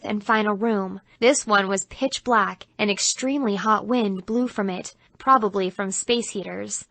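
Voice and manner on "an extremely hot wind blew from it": usual speaking voice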